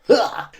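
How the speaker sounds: high, sudden "ha!"; mock exclaimed "ha". Showing how she flings the cup, mock exclaimed "ha". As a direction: throw out a short, high "ha!". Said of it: "She just goes".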